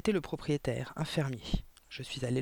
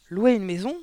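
higher, louder question rising sharply at the end; narrated outburst of indignant disbelief; outraged yell; 4 semitones higher, 8 dB louder than usual. A narrator's reading. Aunt to niece who rented a house, outraged yell. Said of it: "a-t-elle hurlé".

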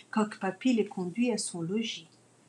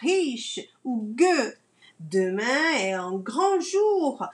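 royal herald; 4 semitones higher than usual. Acted. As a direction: proclaim it to the whole town square. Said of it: "Coq Papy est crieur public".

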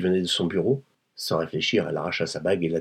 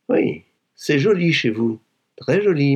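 gushing admiration; 8 semitones higher, 5 dB louder than usual. Acted. Visitor admiring the rich woman's home, gushing.

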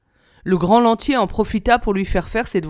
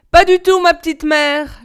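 high, louder reply through a door; raised-voice answer from another room; son calling back; 7 semitones higher, 5 dB louder than usual. A narrator's reading. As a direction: call back to your mother, starting high.

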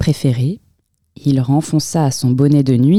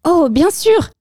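high, squeaky mouse agreeing; little mouse; 13 semitones higher than usual. A narrator's reading. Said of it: "fit la souris".

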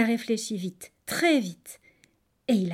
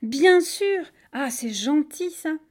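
high, loud, grateful delight; elated agreement easing into pleased gratitude; very happy; 6 semitones higher, 6 dB louder, slower than usual. A narrator's reading. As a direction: gush with happy thanks. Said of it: "a dit le crocodile tout heureux".

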